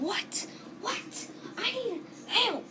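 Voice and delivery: high, slowing, scared pleading; mock panic, begging for help; panicked cry for help. Kids panic at the animatronic.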